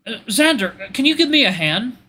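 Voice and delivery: high, urgent plea for help; panicked request. Acted. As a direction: ask for help with rising panic. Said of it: "this panic in his voice".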